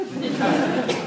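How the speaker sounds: high breathy falling laugh; amused laugh at the dolphin message; real laugh. Real, not acted. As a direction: let out a little giggle. Laughing after dolphin quote.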